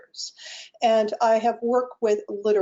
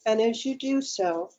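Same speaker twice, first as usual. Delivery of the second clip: calm, unhurried meditation guide with long pauses; guided meditation. Acted. Guide leading online participants through breathing and self-massage, guided meditation. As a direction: lead them gently into the breath, phrase by phrase.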